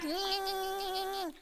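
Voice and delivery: soft repeated "nyeh" whine; comic rabid nyeh-nyeh; mock rabid noises. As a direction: imitate a rabid kid's noises. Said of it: "I didn't wanna get rabies".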